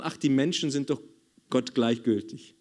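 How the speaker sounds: normal talking voice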